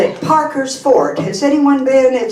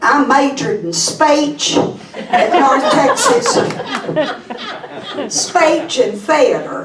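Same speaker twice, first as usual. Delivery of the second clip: crude Texas accent; 6 semitones higher than usual. Shown as a demonstration. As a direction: lay on a homegrown Texas accent. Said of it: "probably noticed our Texas accent".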